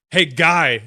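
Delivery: loud mock heckle from behind; imitated calling out. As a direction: call out sharply like a stranger. Stranger calls out in line.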